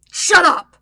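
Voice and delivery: loud, high outburst; shout. A narrator's reading. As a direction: explode at a taunting sister. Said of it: "Zuko shouts".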